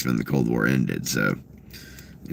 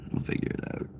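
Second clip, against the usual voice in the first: breathy whisper, no voice; 12 dB quieter, faster than usual. Acted. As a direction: hush it to yourself. Whispered repeat of the line.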